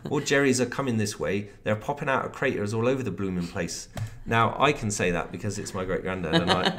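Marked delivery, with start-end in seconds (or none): 0.1-3.9 light Devon burr on a soldier's warning, lightly voiced; a hint of a West Country Tommy, not overdone; West Country accent. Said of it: "he was actually from the West Country".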